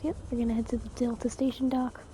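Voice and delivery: normal talking voice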